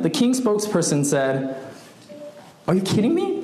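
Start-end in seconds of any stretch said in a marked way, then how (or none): 2.7-3.4 mildly angry, high-pitched disbelief; irritated. Acted. King's spokesperson rebuking a bold petitioner, irritated. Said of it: "He was angry, he was irritated".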